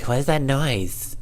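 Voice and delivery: high, slow silly imitation; mocking stock voice for everybody; put-on girlfriend voice. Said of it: "just the voice I give everybody".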